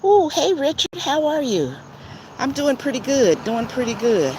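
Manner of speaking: high, loud squeals of hello to chat friends; excited greeting. Real, not acted.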